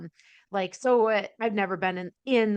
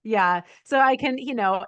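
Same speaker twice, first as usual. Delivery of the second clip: ordinary delivery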